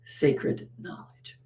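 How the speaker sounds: hushed, awed repetition; hushed reverence. Shown as a demonstration. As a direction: let it fade into a whisper. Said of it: "reverent repetition".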